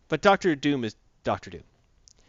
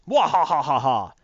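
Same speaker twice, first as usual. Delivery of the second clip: loud mock villain laugh; evil cackle; 6 dB louder than usual. Osborn realizing Goblin's still inside, loud mock cackle.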